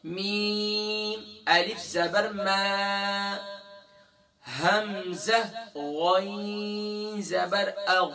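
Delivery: "ما اغنا" built letter by letter, slow and drawn out, غ from the top of the throat; slow spelled recitation. Shown as a demonstration. Quran teacher spelling out "ما اغنا" for his students, slow and chanted. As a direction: spell out each letter slowly, then join them into "ما اغنا". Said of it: "ابھی دیکھیے".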